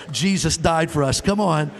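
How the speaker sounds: ordinary delivery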